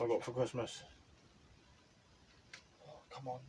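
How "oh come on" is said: ordinary talk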